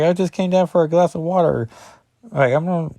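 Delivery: a teen covering up, lightly voiced; hammed-up teen excuse; mock embarrassed teen. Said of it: "she's like".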